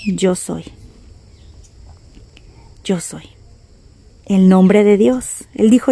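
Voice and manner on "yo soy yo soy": low, soft answer with a long pause; Jesus. Acted. Jesus replying to the tribunal, quiet. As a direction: answer quietly, letting it drop. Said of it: "Pero Jesús respondía".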